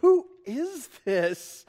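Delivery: high, slow, stretched-out "who is this"; awed disbelief. Retold or imitated. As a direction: marvel slowly at the calmed storm. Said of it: "they're like".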